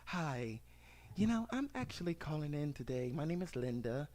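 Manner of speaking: Karen caller impression. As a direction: play a polite complaining Karen on the phone.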